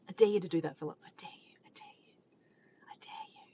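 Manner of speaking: whispered playful dare; hushed mock challenge; teasing whisper.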